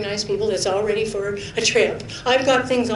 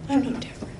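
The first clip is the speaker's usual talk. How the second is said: quiet self-rebuke; 3 semitones lower, 12 dB quieter, faster than usual. Retold or imitated. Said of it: "and then I think".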